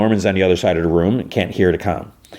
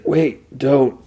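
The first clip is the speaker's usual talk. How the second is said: alarmed protest; 6 semitones higher than usual. Retold or imitated. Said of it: "and he's like".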